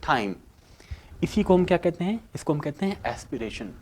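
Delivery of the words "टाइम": टाइम with a breathy th; टाइम said as thaim; aspirated t. Shown as a demonstration. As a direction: puff of air after the t in टाइम. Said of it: "थोड़ा है sound निकलता है ट के साथ".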